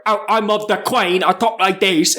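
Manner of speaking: broad mock British country accent, Queen as "Quayne"; mock a British bumpkin, vowels twisted. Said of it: "they don't even say it like that".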